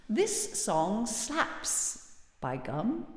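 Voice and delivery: mock British, slow and soft; bad British accent. Streamer teasing a UK viewer with slang, bad British accent. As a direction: put on a hammy British accent, drawn out slowly. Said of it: "a bad British accent".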